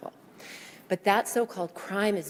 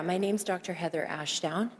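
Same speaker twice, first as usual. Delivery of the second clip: ordinary talk, nothing marked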